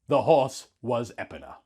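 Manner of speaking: ordinary delivery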